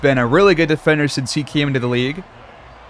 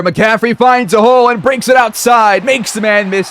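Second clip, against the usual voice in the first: excited play-by-play; 6 semitones higher than usual. Real, not acted. Commentator calls McCaffrey breaking outside, excited play-by-play. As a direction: pitch jumps up as the run breaks open. Calling a breakaway run live.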